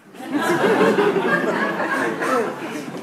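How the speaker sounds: high, loud burst of laughter. Real, not acted. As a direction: crack up loudly. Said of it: "Very good".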